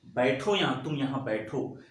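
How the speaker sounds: ordinary talk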